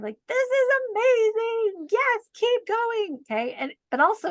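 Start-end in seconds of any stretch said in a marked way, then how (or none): 0.3-3.2 much higher, slow and drawn out; mock blissful. Guest recalling her eyes-closed self-talk, higher and slow. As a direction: stretch the words out in forced delight. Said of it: "doing this meditation of".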